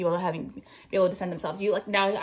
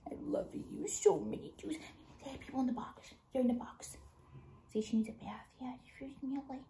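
soft breathy whisper to her dog; 2 semitones higher, 14 dB quieter than usual. Real, not acted. Owner whispers baby talk to her dog Ming, whispering. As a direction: hush it close to the dog's ear.

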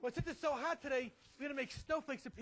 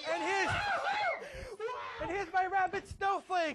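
loud, higher showman reveal; kids party magician; 8 semitones higher, 7 dB louder, slower than usual. Acted. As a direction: present the trick to the kids.

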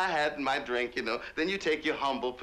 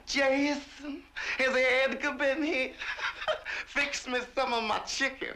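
much higher, mincing Southern belle voice, with a laugh; high, fluttery Southern lady ordering chicken; imitating the Southern lady boss; 13 semitones higher than usual. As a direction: mimic the flighty Alabama mistress calling for her servant. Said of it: "And she'd enter and say".

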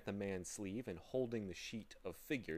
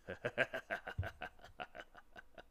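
villainous laugh; 3 dB quieter than usual. A narrator's reading. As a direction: laugh long like a scheming villain. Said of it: "with a villainous laugh".

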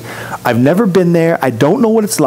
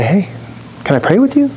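much higher, gentle caring voice; caring offer; 6 semitones higher, faster than usual. Pretend. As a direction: sweetly offer help to a stranger. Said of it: "to say".

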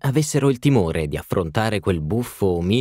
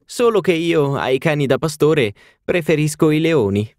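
higher, louder teasing society lady; playful hostess; 7 semitones higher, 4 dB louder than usual. A narrator's reading. Hostess joking to the duchess, teasing and higher. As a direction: tease the guests, bright and high.

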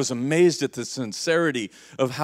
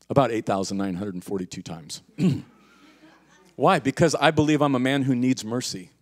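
normal talking voice

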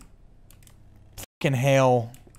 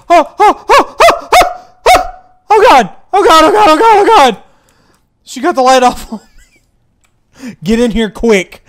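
panicked high screaming; being chased; 18 dB louder than usual. Real, not acted. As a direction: scream in terror while fleeing. Fleeing the ghost in panic.

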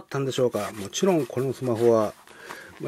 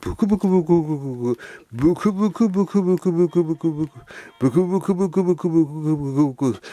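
bubbling noise; 6 semitones higher than usual. Pretend. Voicing bubbles as phone sinks.

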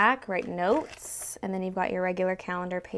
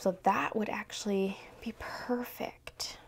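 slow whispered aside; 8 dB quieter, slower than usual. Real, not acted. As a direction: whisper it to yourself. Whispered to herself.

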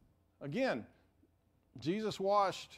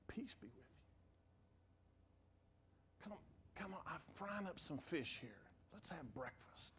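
much softer and lower, gentle; tender invitation; 3 semitones lower, 19 dB quieter than usual. Acted. Jesus calls Peter to breakfast, tender and soft. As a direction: invite him in like a forgiving friend.

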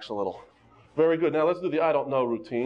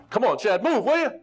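much higher and louder, playful push; urging; 9 semitones higher, 5 dB louder than usual. Real, not acted. Instructor prodding Chad to move his hips, loud playful urging.

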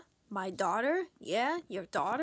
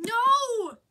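loud, high protest; shouted no; 8 semitones higher, 10 dB louder than usual. Acted. Kid protesting the Christmas special choice, shouting. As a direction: yell it to stop them.

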